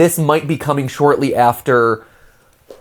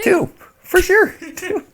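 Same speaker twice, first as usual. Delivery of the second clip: mock high. Repeats friend's reading of FS.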